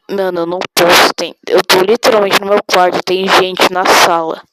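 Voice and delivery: loud yelled protest; shouting. Pretend. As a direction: yell that you can't yell. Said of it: "grita alto".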